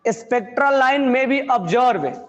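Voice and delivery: words spaced out slowly for students to write; dictating a question to the class; slow dictation. Real, not acted.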